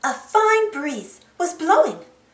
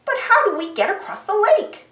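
exclaiming; 6 semitones higher than usual. A narrator's reading. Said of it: "Boots exclaimed".

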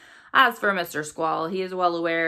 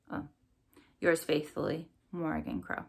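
usual speaking voice

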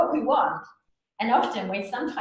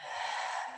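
soft breath out; 11 dB quieter than usual. Shown as a demonstration. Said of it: "Let's do one more time".

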